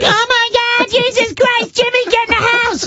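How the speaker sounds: very high mock-panicked cry; panicking relatives. Family calls Jimmy inside away from a fight, high mock panic. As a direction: imitate a whole family shrieking in alarm. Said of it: "everyone's like".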